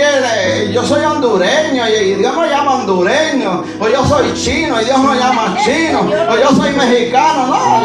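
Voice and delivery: ordinary talk, nothing marked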